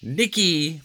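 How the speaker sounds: stern mother's call; scolding mother. A narrator's reading. Mother scolding Nicky for being rude. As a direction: call him out sharply. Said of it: "Mother scolded".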